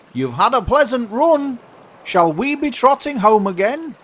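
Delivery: high-pitched character voice; chirpy carpenter. Acted. Carpenter inviting oysters to trot home, high call. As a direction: ask it in a high, lilting call.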